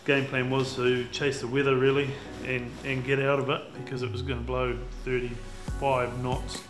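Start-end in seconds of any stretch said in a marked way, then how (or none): none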